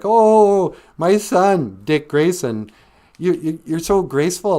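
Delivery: high, loud cooing mom voice; doting mother. Acted. Circus mom catching her son on the trapeze, gushing. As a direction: gush lovingly, drawing out the praise.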